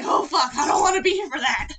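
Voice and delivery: shouting as the pet; shouted dog voice. Retold or imitated. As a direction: yell it as the startled dog. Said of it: "he was like".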